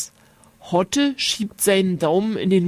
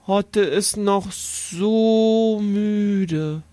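sleepy; much slower than usual. A narrator's reading. Sleepy boy read slowly.

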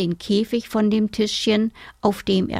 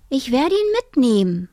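put-on higher, resolute child's voice; determined girl; 5 semitones higher, 3 dB louder than usual. A narrator's reading. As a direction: announce it as a girl who has decided. Girl announces her decision.

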